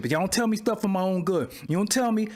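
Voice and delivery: normal talking voice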